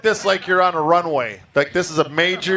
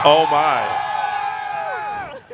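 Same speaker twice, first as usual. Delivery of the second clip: swooning; much slower than usual. Pretend. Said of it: "I just had a moment. My heart fluttered".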